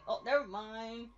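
ordinary delivery

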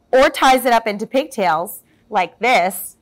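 ordinary delivery

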